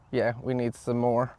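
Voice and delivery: ordinary delivery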